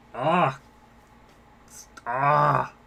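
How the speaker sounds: loud overwhelmed shout; shocked yell. Real, not acted. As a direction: yell out in disbelief at the gift. Streamer overwhelmed by big donation.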